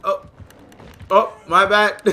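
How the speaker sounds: ordinary talk, nothing marked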